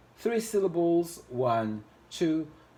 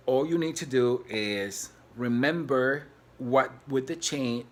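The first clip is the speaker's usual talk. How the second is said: ordinary talk, nothing marked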